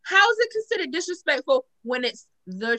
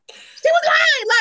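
slow, very high, drawn-out protest; high-pitched insistence; 14 semitones higher, 4 dB louder, much slower than usual. Real, not acted. Friend defending the child's word, high insistence. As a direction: stretch it out high and incredulous. Repeats protest about nana.

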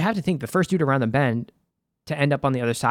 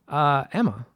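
ordinary talk, nothing marked

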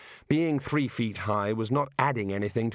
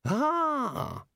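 high surprised "Ah"; a sharp puzzled gasp; high startled gasp from the small god. A narrator's reading. God character wakes, puzzled.

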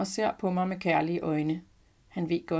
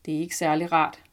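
ordinary delivery

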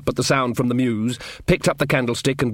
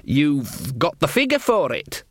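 slow, groping compliment; inventing madly; 2 semitones higher, slower than usual. A narrator's reading. Guard flattering the queen in armour, improvising madly. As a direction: make up flattery as you go. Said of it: "inventing madly".

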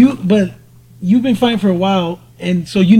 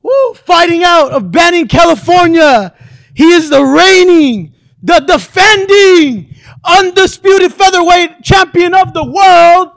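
booming ring announcer, drawn-out name; frantic mock sports commentary; 8 semitones higher, slower than usual. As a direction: hype up the fighter's walkout like a ring announcer. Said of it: "after that sick-ass intro".

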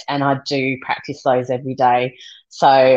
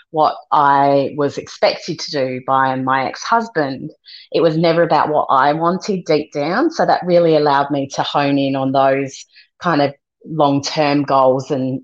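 ordinary talk, nothing marked

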